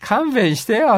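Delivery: high-pitched, laughing "give me a break"; mock protest. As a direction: beg off in playful exasperation. Mock reaction to showy English. (笑いながら大げさに勘弁してと)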